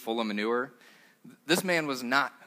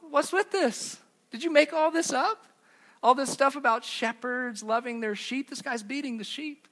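much higher, mock-indignant disbelief; hammed-up shocked students, voice pitched way up; incredulous students; 11 semitones higher than usual. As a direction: play the baffled students calling it out. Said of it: "And the students go".

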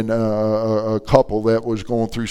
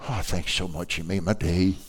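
soft, low, grateful little voice; touched thanks; 4 semitones lower, 7 dB quieter than usual. Retold or imitated. Little lady given flowers in nursing home, touched. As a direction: thank them gently, quite moved.

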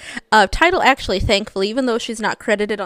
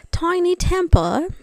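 British accent; 8 semitones higher, slower than usual. Shown as a demonstration. Podcast host shows her cohost the name in a British accent. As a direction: drawl the name out British-style, pitched up. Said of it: "like, a British accent with it if you wanted to".

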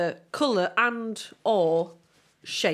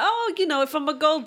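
gold digger voice; 8 semitones higher, 4 dB louder than usual. Pretend. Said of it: "I'd be like".

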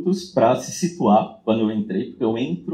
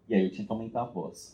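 ordinary delivery

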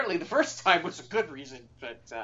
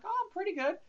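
innocent squeak; 4 dB quieter than usual. Retold or imitated. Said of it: "I'm like".

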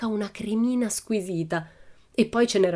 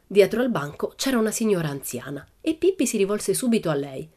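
ordinary delivery, nothing marked

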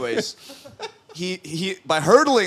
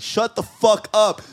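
raised, drawn-out exasperation; retold angry outburst; 6 semitones higher, 5 dB louder, slower than usual. Telling off a driver over a parking spot, exasperated. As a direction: snap back at the other driver, higher and louder.

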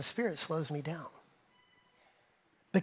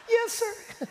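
much higher, sarcastic little yes sir; husband voicing his wife's sarcastic answer; mock spouse reply. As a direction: play the spouse answering dryly. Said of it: "Ask your spouse".

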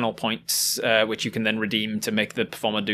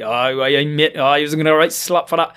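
louder, badly faked Geordie; bad Geordie accent; 3 semitones higher, 5 dB louder than usual. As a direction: have a clumsy go at Geordie. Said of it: "not good at Geordie".

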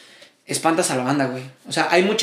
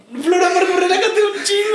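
excited plea; 10 semitones higher than usual. Real, not acted.

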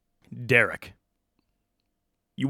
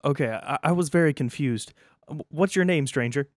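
higher, stammering first-day worker; nervous new guard; 7 semitones higher, 5 dB louder than usual. Acted. New vault guard on his first day, worried and higher-pitched. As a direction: fumble the words like a worried rookie guard.

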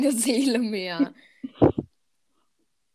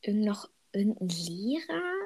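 whispered question to herself; whispering; 8 dB quieter than usual. Real, not acted. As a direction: whisper while thinking hard. Thinking aloud under breath.

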